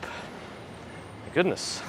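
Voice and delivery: ordinary talk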